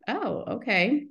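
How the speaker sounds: slow, dawning "oh okay". Retold or imitated. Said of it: "And I'm like".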